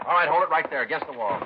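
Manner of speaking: loud, high order to freeze; shouted command. Acted. Detective cornering the suspect, shouted command. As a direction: stop him cold with your voice.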